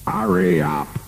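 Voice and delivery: high-pitched shout without real volume; angry shout. A narrator's reading. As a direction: holler impatiently from upstairs, pitched high. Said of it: "he shouted".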